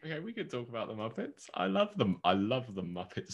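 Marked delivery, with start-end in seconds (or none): 0.0-2.2 high excited gushing. Real, not acted. A fan gushing to a friend about the Muppets, high and excited. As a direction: squeal with fandom.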